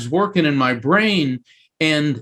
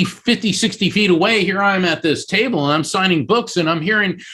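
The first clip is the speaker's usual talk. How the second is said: ordinary talk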